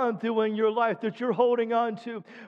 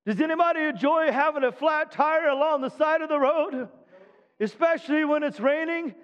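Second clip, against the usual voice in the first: preaching; 3 semitones higher than usual. Acted. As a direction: build it up for the congregation. Preacher quoting scripture, exhorting.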